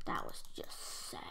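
whisper. Real, not acted. After losing, hushed comment.

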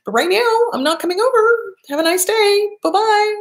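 a chirpy fake-nice kiss-off; sweetly dismissive. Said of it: "it's like".

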